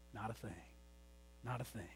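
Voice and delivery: quiet emphatic reassurance; hushed. Real, not acted. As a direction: let it drop to a near whisper. Quiet repeated answer.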